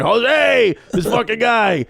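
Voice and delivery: high, jovial mock holler; boisterous teasing dad. Dad greets houseguest by the wrong name, boisterous. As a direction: holler it like a joshing old-school dad.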